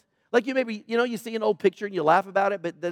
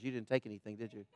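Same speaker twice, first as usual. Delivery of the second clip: quiet, amused teasing question; mock-scolding with a chuckle; teasing laugh; 10 semitones lower, 18 dB quieter, faster than usual. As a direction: tease him through a soft laugh. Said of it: "she goes".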